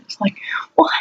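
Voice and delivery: whisper. Real, not acted. Rhetorical aside about vague posts.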